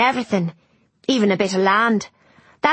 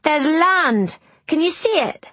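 put-on high, louder boy's cry; excited boy calling out; 10 semitones higher, 4 dB louder than usual. A narrator's reading. Boy urges the girl to look at the shore, calling excitedly. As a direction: call out eagerly, pitch leaping up. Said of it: "he called out".

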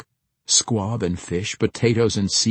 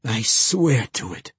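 growled vow, only mildly raised; growling; 3 semitones higher than usual. A narrator's reading. Young man vowing to the full moon, growling. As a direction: swear it with a touch of growl. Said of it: "he said with a growl".

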